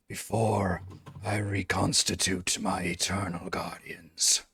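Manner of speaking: slow, soft, breathless whisper from the dark; eerie whispering guardian. Acted. Ancient voice grants the heroes one question, slow whisper. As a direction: whisper it slowly like an ancient keeper of the tomb.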